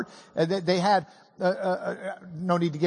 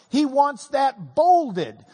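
bolded said slower and louder; emphatic; 3 semitones higher, 5 dB louder, slower than usual. Shown as a demonstration. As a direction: lean hard on bolded, drawn out and loud. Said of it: "He wants that underlined".

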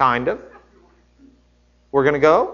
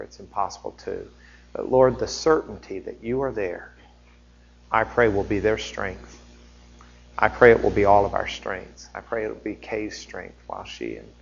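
prayer; 6 semitones lower, 5 dB quieter than usual. Real, not acted. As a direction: pray quietly, head bowed.

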